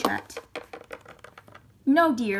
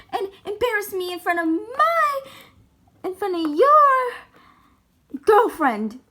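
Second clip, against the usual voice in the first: higher, louder defiant scolding; indignant mom; 7 semitones higher, 4 dB louder than usual. Acted. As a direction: stand your ground, offended mother. Mom refuses to leave, scolding.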